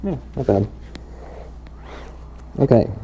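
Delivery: talking through a respirator, muffled; words dampened behind a mask. Real, not acted. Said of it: "A little muffled".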